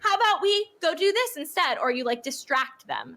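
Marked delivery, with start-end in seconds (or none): 0.0-1.8 put-on high sweet voice as if to a child; cheery redirect. Park character distracting a guest, high coaxing voice. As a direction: coax them higher and sweeter. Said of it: "You're like".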